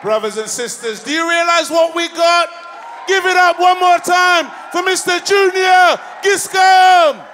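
high, loud hype-man shout; shouted MC call for applause; rousing host shout. Real, not acted. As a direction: whip the crowd up for applause. Host rouses crowd for applause.

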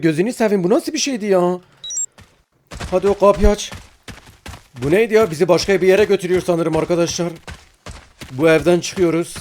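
normal talking voice

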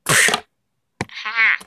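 usual speaking voice

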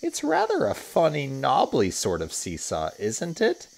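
put-on a careful girl unsure of it; doubtful older girl.

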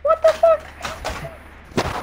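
stretched very high squeak; squeaker voice. Shown as a demonstration. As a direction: drag it out in a kid squeak. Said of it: "This fucking squeaker voice".

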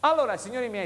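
upbeat high sales pitch; infomercial. Real, not acted. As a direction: sell it like a TV shopping host.

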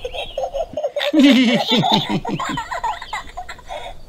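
high-pitched giggling; light, very high giggle; children's giggles. A narrator's reading. Peppa and George laugh at Joey's surprise, giggling. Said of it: "Laugh Peppa and George".

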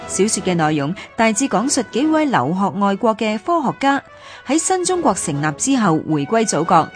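ordinary delivery

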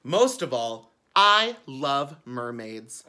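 put-on a child gushing about mermaids; excited. A narrator's reading. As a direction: share your favourite thing with delight. Book's eager confession, read loud.

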